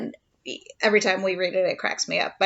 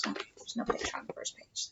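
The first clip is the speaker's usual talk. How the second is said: fast, breathy whisper aside; a quick whispered aside to the little one; 15 dB quieter, faster than usual. Real, not acted. Whispering aside while reading aloud.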